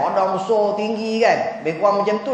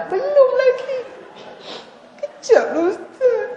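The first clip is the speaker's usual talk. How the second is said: high, teary mock woman's voice, laughing; high crying woman. To the ustaz, a sobbing wife stalls in a high whine. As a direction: squeak it out like a weeping wife stalling.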